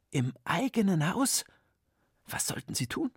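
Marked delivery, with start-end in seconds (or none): 2.3-3.1 hushed whispered worry. A narrator's reading. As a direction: breathe the question in fear. Worried teachers, hushed question.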